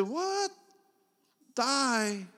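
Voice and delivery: a stranger hears a man wants to die and is shocked; shocked disbelief. Acted. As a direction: jolt up in disbelief.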